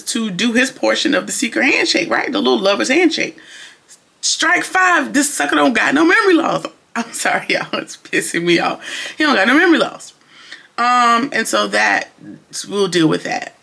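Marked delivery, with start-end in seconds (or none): 4.2-6.6 high, emphatic exasperation; exasperated. Real, not acted. Exasperated verdict. 9.2-10.1 emphatic, higher and louder verdict; fed-up higher declaration; exasperated. Real, not acted. Exasperated repeat.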